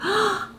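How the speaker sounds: sharp, high little gasp of hope. Retold or imitated. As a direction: gasp as if relief just dawned. Marilyn hears hopeful news.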